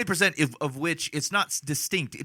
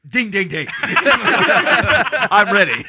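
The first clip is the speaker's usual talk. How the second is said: loud, higher-pitched mock readiness; mock eagerness; 5 semitones higher, 8 dB louder than usual. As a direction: play it loud and over-eager. Acting out the eager Sickle.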